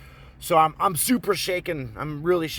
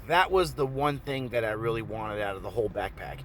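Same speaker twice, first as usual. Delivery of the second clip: ordinary talk, nothing marked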